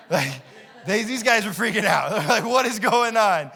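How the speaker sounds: laughing through the line. Real, not acted. Preacher laughing at the disciples freaking out. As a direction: giggle while telling it.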